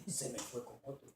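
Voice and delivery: a hint of a grieving husband; heartbroken brother. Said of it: "me dijo". (confesión apagada del hermano)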